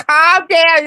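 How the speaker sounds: high, loud mimicked command; mock the people who tell you to relax; mocking imitation. Mimics people she hates hearing.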